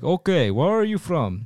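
put-on a Legion recruiter in light Russian accent; mock Russian accent. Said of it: "giving a Russian accent".

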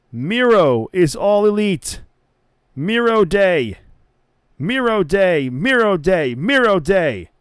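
loud, high, drawn-out announcement; hyped wrestling podcast host proclaiming; excited hype. Real, not acted. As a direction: chant his name like big news.

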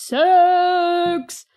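SIX-FOOT-SIX stretched slow, high and loud; drawn-out emphasis. Real, not acted. Recap, awed emphasis on height.

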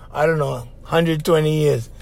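usual speaking voice

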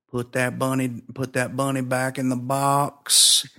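quiet attempt at a Southern accent; bad Southern accent. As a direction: lightly imitate a Southern tough guy. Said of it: "my bad Southern accent".

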